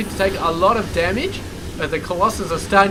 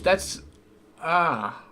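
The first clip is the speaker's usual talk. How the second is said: talking around something stuck in the mouth; words caught on something in the mouth; 3 dB quieter than usual. Real, not acted. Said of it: "got something in my mouth keeps annoying me".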